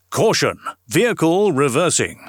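lightly voiced recorded warning; truck reversing alarm. Shown as a demonstration. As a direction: announce it like a lorry's reversing message. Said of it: "you may well hear me saying".

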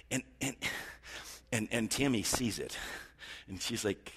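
usual speaking voice

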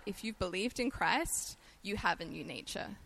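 normal talking voice

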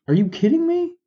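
can't believe what he's hearing, squeak it high; incredulous. Real, not acted. Ranting to listeners about the Senate break, shrill incredulous disbelief. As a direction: voice leaps way up in disbelief, pitch soaring on me.

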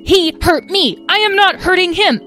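high, loud sibling squabble; indignant protest. A narrator's reading. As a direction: argue back hotly, hitting each word. Dragon siblings quarrelling.

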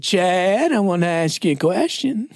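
high, lilting Southern drawl; swooping Southeastern accent; Southern pastor impression. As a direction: imitate a Southern mentor calling on his pupil. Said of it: "he talked like this".

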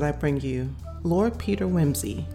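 normal talking voice

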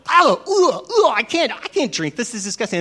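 high, loud ugh of revulsion; hammed-up gagging disgust. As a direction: recoil from the burning drink. He chokes on the chili shot.

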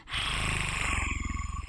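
soft breathy dog growl; growled warning. Shown as a demonstration. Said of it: "that is my growling impression".

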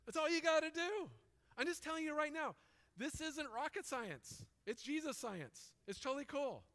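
excited. Real, not acted. Excited preacher punchline.

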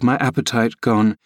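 plain reading voice

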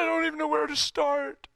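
high, choked tearful voice; imitated crying. Crying son comes into the house, imitated tearful voice. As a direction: imitate a son talking through tears. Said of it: "He's just crying".

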